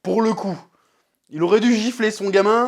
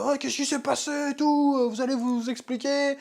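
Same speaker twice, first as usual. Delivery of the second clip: mock indignant king; 7 semitones higher, 5 dB quieter than usual. King demanding his sons explain themselves, mock indignant. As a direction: demand answers in a put-on higher voice.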